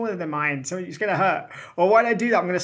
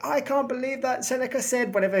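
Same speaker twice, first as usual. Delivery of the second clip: mock incredulous critic; 7 semitones higher than usual. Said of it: "And they're like".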